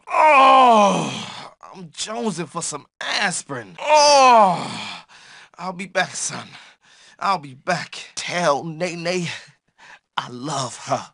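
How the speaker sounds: hurt and fading. Acted. Beaten character collapses, fading out.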